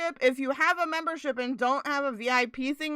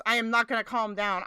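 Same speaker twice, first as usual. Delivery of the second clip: usual speaking voice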